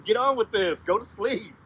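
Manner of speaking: high, loud exasperation; impatient Rabbit. Acted. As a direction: act out a fed-up rabbit. Impatient Rabbit waits for sleep.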